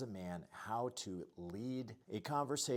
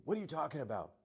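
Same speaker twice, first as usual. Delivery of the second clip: reactive snap; 8 semitones higher, 5 dB louder, faster than usual. Retold or imitated. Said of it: "you're not just going right into".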